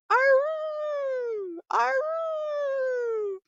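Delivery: long high howl like a lone wolf calling. A narrator's reading. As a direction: throw your head back and howl for the pack. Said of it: "talk to the wolves, ... he started going".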